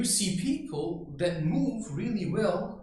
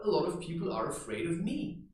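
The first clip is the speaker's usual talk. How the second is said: ordinary delivery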